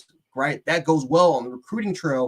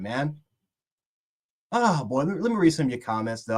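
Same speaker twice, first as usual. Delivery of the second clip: normal talking voice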